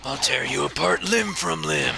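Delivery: lightly voiced roaring brute; Broly voice. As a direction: growl it like a half-hearted brute. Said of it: "a not that bad of a Broly voice".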